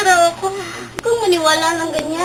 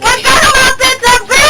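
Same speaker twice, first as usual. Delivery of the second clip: loud and high, pushing him away; terrified yell; scared shout; 6 semitones higher, 8 dB louder than usual. Acted. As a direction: scream at it to stay back. Girl screams at the ghost.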